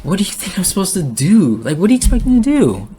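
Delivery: pleading, voice climbing in exasperation; emotional outburst at the viewers; getting emotional. Real, not acted. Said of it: "Sorry that I'm getting emotional".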